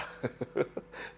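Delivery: a quiet airy laugh at his own joke; soft breathy chuckle. Real, not acted. Host chuckling at his own barrister joke, soft laugh. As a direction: chuckle softly under the breath.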